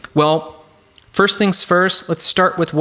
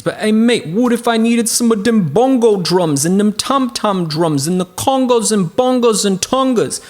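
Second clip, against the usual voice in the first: higher-pitched mock British accent asking a question; mock British student; 9 semitones higher than usual. A pretend British viewer asks about bongos, mock British accent. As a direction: play a cheeky British learner saying mate.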